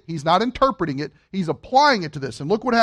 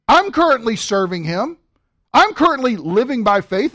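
normal talking voice

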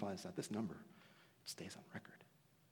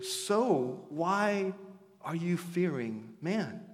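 preaching; 7 semitones higher, 10 dB louder, much slower than usual. Acted. Preacher's climax.

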